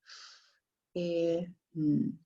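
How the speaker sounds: isolated phonemes f, I, n, each sound alone; sounds said one by one. Shown as a demonstration. Teacher modelling the sounds of fin, isolated. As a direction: break "fin" into its three sounds. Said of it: "the smallest units of sound are".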